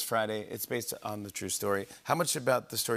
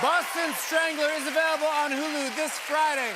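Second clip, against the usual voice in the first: announcer read; 13 semitones higher than usual. Acted. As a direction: sell it to the studio crowd. Host's break announcement.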